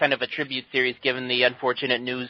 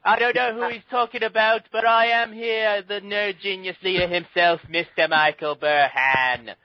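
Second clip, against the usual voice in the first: hammed-up fake British host voice, higher and drawn out; Michael Burhan impression; 7 semitones higher, slower than usual. [name] mocking the absent host's show opening in a hammed-up British voice. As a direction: play the co-host as a pompous British showman, slowly. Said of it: "that was less Michael Burhan and more my Bob Hoskins".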